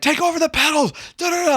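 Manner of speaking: high-pitched imitation of a yelling instructor; screaming instructor. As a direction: shriek it like a frantic flight instructor, hammed up. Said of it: "screaming at the top of his lungs".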